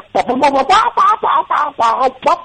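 high pa-pa-pa mock solo; imitated solo. Musician imitating the song's odd solo with a high scat. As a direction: scat the funny solo line. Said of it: "It's just like".

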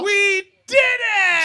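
high, loud, drawn-out cheer; slow, high victory cry; triumphant shout. Real, not acted. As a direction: stretch it into a big celebratory yell. Cheering at the show's close.

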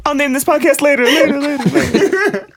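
high, echoing producer drop. Pretend. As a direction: sing it like a radio drop fading. Mock podcast drop echo.

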